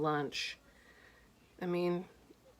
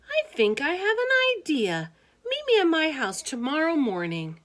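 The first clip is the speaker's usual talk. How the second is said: put-on high, louder girl's voice; Harriet's voice; 10 semitones higher, 4 dB louder than usual. Harriet teasing George at lunch, high girlish voice. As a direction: pipe up as a chatty girl.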